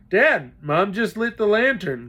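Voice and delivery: high, loud call; boy calling to dad over the storm; excited shout. Acted. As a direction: call the good news across the water. Boy calls out over flood.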